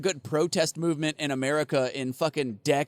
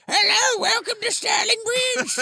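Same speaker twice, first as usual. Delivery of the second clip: very high, loud mock-Cockney squawk; Monty Python Cockney; 4 dB louder, slower than usual. Severed head greeting visitors to the bridge, high cartoon Cockney. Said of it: "Monty Python Cockney accent".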